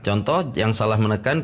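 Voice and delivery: normal talking voice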